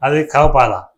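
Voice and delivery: emphatic, punched verse delivery; forceful poem declamation. Acted. Poet proclaiming who the voters are, forceful declamation. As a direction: proclaim each line to the crowd.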